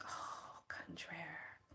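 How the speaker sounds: hushed unvoiced whisper. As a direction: lean in and whisper it. Boss whispers secretively.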